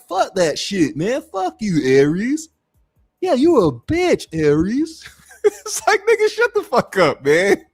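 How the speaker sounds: dismissive mock yelling at Aries; mock rant at Aries. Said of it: "I'm like".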